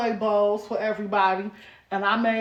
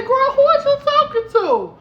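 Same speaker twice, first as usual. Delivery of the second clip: loud, high-pitched incredulous retort; playfully scandalized, pitch shooting up; mock outrage; 13 semitones higher, 6 dB louder than usual. Mock outrage at child's big words.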